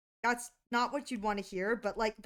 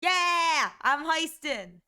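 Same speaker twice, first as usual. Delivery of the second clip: loud, high whoop on "Yeah"; excited cheer; 4 semitones higher, 5 dB louder, much slower than usual. Real, not acted. Streamer celebrating the heist, excited shout.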